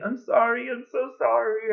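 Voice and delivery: very high mock-sobbing apology; high-pitched imitation of a tearful girl; imitated bawling. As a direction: wail it like the crying cashier. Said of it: "she's bawling and she's saying".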